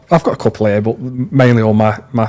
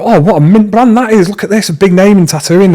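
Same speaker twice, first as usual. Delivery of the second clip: higher, gushing mock praise for a brand; mock impressed; 7 semitones higher than usual. Tattooist mimicking fans who rave about a brand, mock impressed. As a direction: ham up being wowed by a label.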